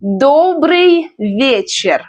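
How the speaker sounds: slow, stretched Добрый вечер; pronunciation demo. Shown as a demonstration. Teacher teaches Добрый вечер, slow careful model. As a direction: draw out each word of Добрый вечер for learners. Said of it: "Let's learn pronunciation".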